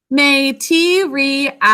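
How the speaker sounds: "Material" slowly as may-tee-ree-al; "Material" syllable by syllable, full vowels; sounded out for spelling. Shown as a demonstration. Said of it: "four syllables".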